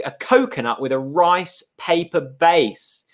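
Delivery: usual speaking voice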